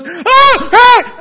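high loud shriek of alarm; wife's startled shriek. Retold or imitated. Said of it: "she goes".

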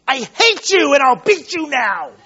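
loud, high mock threat to a child; mock angry parent. Preacher mocking an angry father threatening his kid. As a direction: ham up a furious parent, half laughing. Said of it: "I don't do it in anger".

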